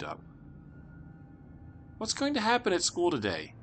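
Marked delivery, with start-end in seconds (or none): 2.0-3.5 lightly voiced young girl, higher; a hint of a curious little sister; little girl asking. Little sister asks her brother, lightly voiced young girl. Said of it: "she asked".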